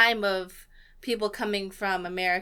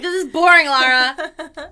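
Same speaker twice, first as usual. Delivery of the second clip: much higher and louder whiny protest; mock bored complaint; 7 semitones higher, 12 dB louder, slower than usual. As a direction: loudly whine at the character. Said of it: "I was like".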